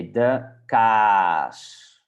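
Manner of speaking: kaas with the aa stretched out very long; overdone long A. Shown as a demonstration. Teacher overdoes it for beginners, long drawn-out kaas. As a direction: drag out the vowel in kaas, slowly, as a model. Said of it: "you can overdo it".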